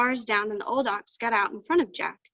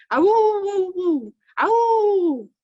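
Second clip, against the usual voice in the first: long, high hound-dog howl; drawn-out hollering dog cry; lonesome hound howl; 8 semitones higher, 8 dB louder, much slower than usual. A narrator's reading. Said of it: "bark a while and then holler".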